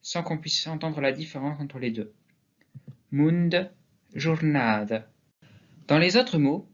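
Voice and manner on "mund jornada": "Mund" and "jornada" ending in a weak schwa; stressed middle, faint neutral ending on "Mund"; final vowel as E. Shown as a demonstration. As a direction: reduce the last vowel of "jornada" to "uh". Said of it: "sont prononcés E".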